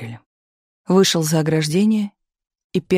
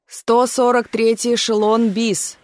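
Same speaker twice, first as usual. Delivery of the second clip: megaphone shout; 5 semitones higher, slower than usual. A narrator's reading. Railway major orders troops aboard: megaphone shout. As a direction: bark the boarding order through a loudhailer. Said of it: "заорал жестяной рупор".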